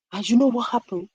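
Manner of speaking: plain reading voice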